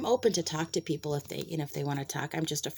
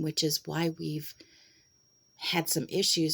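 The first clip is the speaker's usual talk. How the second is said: ordinary talk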